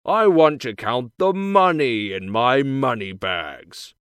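big man bellowing greedily; giant's shout. A narrator's reading. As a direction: shout it loud as the giant demanding his money. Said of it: "he shouts".

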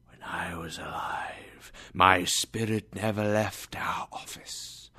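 a ghost breathing out his warning; hushed, hollow spirit voice; ghostly whisper. Acted.

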